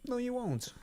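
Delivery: playful contradiction. Acted. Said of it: "self-correcting joke".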